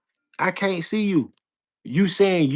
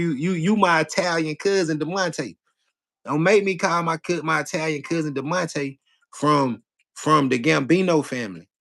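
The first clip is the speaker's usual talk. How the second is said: usual speaking voice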